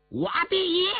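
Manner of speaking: high-pitched defiant yell. A narrator's reading. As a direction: cry it out high as a boast. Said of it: "印额挑着眉毛大叫了一声". (挑衅地高声大叫)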